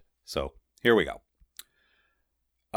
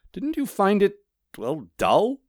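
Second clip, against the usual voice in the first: high character voice, pausing before the key word; high, halting, puzzled question; hesitant questioner; 12 semitones higher, slower than usual. A narrator's reading. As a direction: ask tentatively in a raised pitch. Postman's hesitant question to golem.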